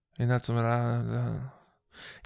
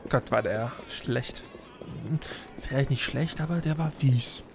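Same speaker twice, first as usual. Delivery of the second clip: ordinary talk, nothing marked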